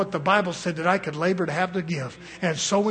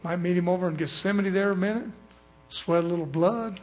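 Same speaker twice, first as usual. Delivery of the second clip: ordinary talk, nothing marked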